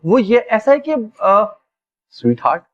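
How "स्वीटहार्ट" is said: much lower, soothing sweet-talk; lowered, honeyed coaxing to his bride. Acted. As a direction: coax her like calming a child. Groom sweet-talking upset bride.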